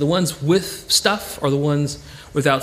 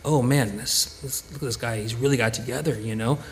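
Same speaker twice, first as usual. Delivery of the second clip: quieter, admiring inner thought; 5 semitones lower, 5 dB quieter than usual. Retold or imitated. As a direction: muse softly to yourself in awe. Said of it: "something that tells us that".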